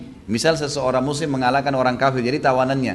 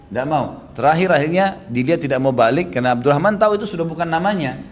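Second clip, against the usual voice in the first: ordinary talk, nothing marked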